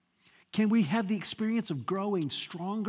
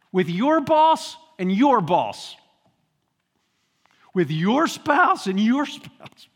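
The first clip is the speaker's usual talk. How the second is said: louder, slow emphatic lines; loud preaching; 2 semitones higher, 8 dB louder than usual. Acted. Preacher exhorting the congregation, loud and slow. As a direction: hammer each phrase.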